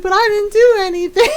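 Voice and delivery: high, loud mock whine; imitated protest. Retold cousin protesting his innocence.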